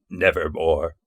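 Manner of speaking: soft, low, eerie croak of a word; raven's reply. Acted. Raven answering the grieving scholar, hushed and eerie. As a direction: let the bird answer, hushed and hollow. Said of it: "Quoth the raven".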